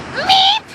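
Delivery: tiny high-pitched squeak; high squeaky meep. Retold or imitated. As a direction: squeak it out like a mouse. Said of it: "Katie goes".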